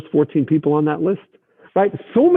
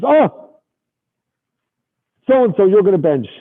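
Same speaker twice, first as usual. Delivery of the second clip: host calling out; 5 semitones higher, slower than usual. Retold or imitated. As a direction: sing out the name to the dais. Host calls out to dais.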